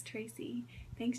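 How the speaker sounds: ordinary delivery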